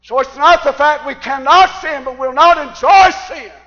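high, loud preacher's cry; full-force preaching. Acted. Preacher telling church about not enjoying sin, full-force preaching. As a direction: drive it home at full volume.

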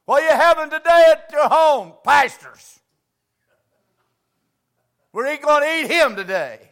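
loud, high and eager, calling out to the pastor; eager invitation. Retold or imitated. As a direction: call the pastor over to dinner. Folks inviting pastor to dinner.

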